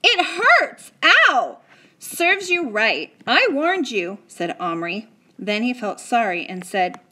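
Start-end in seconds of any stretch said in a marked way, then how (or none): 0.0-1.6 loud, high complaint of pain; pained yell. A narrator's reading. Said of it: "Patrick kept yelling". 2.0-4.2 slow, loud told-you-so; boy scolding his friend who ignored a warning. A narrator's reading.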